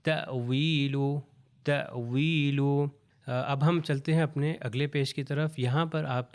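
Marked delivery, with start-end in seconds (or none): 0.0-2.6 تأویل with a small glottal jolt after تَ; slight jolt on hamza. Shown as a demonstration. Teacher showing تأویل with a light hamza jolt. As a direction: say تأویل, catch the hamza briefly. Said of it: "حمزہ ہی پڑھا جائے گا تھوڑے سے جھٹکے کے ساتھ".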